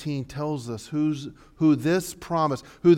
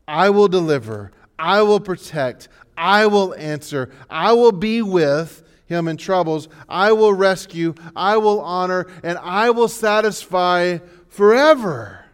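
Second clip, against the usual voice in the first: really fast; 5 semitones higher, 10 dB louder than usual. Shown as a demonstration. Pastor rattling the promises off fast to the congregation. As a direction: rattle the list off quickly, loud. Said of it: "I'll say it really fast".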